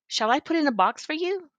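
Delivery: high, lilting shop assistant; polite sales lady. A narrator's reading. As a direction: offer sweetly to wrap it. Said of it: "the sales lady asked".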